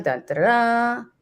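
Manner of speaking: playful drum-roll reveal sound; dramatic sting. Pretend. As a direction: intone a soap-opera cliffhanger cue. Imitates dramatic sting before reveal.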